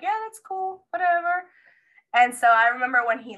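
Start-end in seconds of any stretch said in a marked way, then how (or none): 0.0-1.5 airy, much higher and offhand; breezy nonchalance. Retold or imitated. To the doctor about coming back later, breezy and careless. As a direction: toss it off like it's no big deal.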